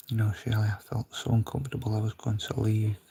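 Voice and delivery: soft hushed whisper. Real, not acted. Whispering to Shirley about feeling uncomfortable. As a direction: lean in and whisper it.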